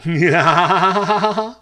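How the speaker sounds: loud, rising stuttered "yeah-ha-ha" laugh; imitated stoner laugh. Comic imitating people smoking weed, loud mock laugh. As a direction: fake a long, dopey machine-gun giggle. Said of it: "they always laugh the same way".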